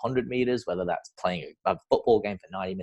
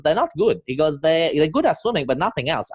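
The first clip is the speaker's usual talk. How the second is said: much higher Zimbabwean accent imitation; funny accent; 9 semitones higher, 4 dB louder than usual. Swim coach mocking swimmers who can't cook, in a high Zimbabwean accent. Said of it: "he used to have this funny accent".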